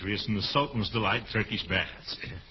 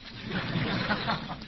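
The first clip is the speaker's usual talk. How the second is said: chuckle; 9 semitones higher, 4 dB quieter than usual. Acted. Laughing at own pun.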